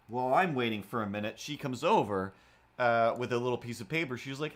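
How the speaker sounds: ordinary delivery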